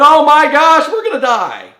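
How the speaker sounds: high, loud mock panic; hammed-up terror of drowning; panicked cry. Disciples in the sinking boat, crying out in mock panic.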